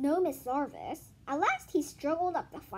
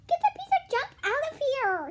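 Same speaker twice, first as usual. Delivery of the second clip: higher and louder; rude shout; 13 semitones higher, 7 dB louder than usual. Acted. Mauricia to Louis, rude loud shout. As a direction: throw the insult out loud.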